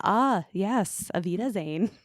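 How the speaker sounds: ordinary talk, nothing marked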